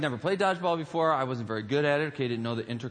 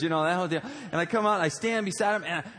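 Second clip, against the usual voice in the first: normal talking voice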